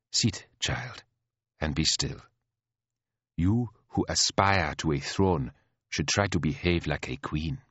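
soft, measured elder queen; patient chiding. Acted. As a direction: chide like a patient teacher with a naughty pupil. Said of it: "sounding like a patient teacher with a naughty pupil".

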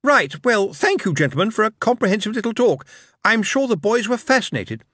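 bemused. A narrator's reading.

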